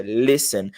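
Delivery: listen as "lesen", silent T; listen demo. Shown as a demonstration. Teacher giving the pronunciation of listen. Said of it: "em segundo a pronúncia".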